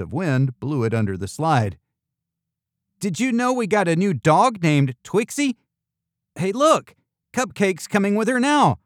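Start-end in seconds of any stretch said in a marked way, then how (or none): 3.0-8.8 put-on high, lively kid character; high-voiced chatty youngster; excited child. A narrator's reading. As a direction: share news eagerly, pitched well up.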